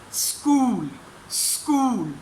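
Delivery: "स्कूल" started straight on S, no vowel before it; correct school. Shown as a demonstration. Teacher models "स्कूल" starting on S, no vowel. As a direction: say "स्कूल" as SKOOL with no leading i. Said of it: "स से start करनी है".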